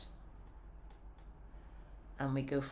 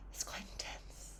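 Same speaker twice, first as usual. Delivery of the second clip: hushed whisper; 14 dB quieter than usual. Real, not acted. Teacher whispering to class, hushed awe. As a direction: breathe it out in awe.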